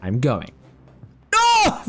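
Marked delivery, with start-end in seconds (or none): 1.3-1.8 very high, loud cry of dismay; real frustrated shriek; anguished shout. Real, not acted. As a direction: yell it as the jump fails. Falls back down the wall.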